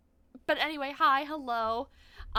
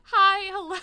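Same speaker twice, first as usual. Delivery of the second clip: 1 bright greeting; 9 semitones higher, 13 dB louder than usual. Real, not acted.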